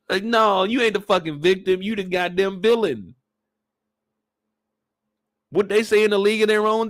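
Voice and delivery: usual speaking voice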